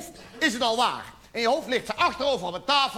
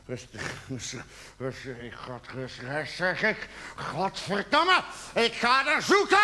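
self-control cracking; 6 semitones lower than usual. Acted. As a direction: start tight and low, then explode. Self-calming cracks into an outburst. (ingehouden, dan woedend uitbarstend)

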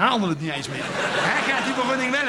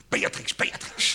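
hushed command; 5 dB quieter, slower than usual. Retold or imitated. Owner quietly sets dog on Willem.

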